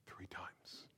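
breathless whispered prophecy; whispered warning. Acted. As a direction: whisper the last words. Jesus' warning dropped to whisper.

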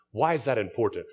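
normal talking voice

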